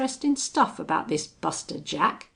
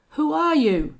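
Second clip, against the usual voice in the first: much louder, higher shouted demand; hoarse shout; 9 semitones higher, 9 dB louder than usual. A narrator's reading. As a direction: yell it at the unseen man. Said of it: "he shouted hoarsely".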